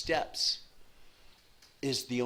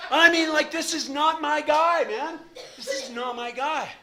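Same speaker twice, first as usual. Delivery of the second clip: laughing exclamation; 11 dB louder than usual. Real, not acted. Laughing at the whiny guy.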